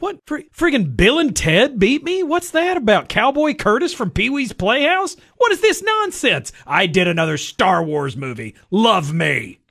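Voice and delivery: high, loud mock indignant rant; hammed-up outrage, wailing in disbelief; George Lucas. George Lucas indignant at losing award.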